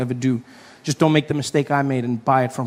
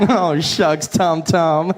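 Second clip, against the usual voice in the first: bashful flirting; 3 semitones higher, 3 dB louder, much slower than usual. Retold or imitated. Flirting with GPS.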